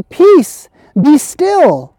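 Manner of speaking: loud high command to the sea; commanding shout. Acted. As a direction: call out to still the storm. Jesus commands the storm.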